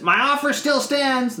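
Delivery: a hint of a pushy severed elf head. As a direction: pitch it up as the bargaining elf head. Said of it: "and the elf head says".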